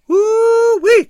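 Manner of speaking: loud, very high "woo-wee" whoop. A narrator's reading. Man calls across the bar, whooping. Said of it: "came a voice from across the room".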